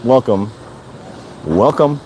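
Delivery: ordinary delivery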